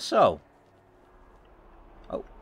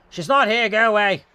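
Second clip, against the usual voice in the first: higher, louder put-on neighbor voice; irritable neighbor; 7 semitones higher, 5 dB louder than usual. Neighbor turns away the caller, irritable put-on voice. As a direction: send a stranger off from behind the door. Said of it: "I don't know why I'm giving them accents".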